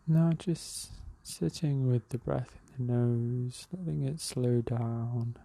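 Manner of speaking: calm, unhurried meditation guide with long pauses; even, measured breathing-exercise voice; guided meditation. Real, not acted.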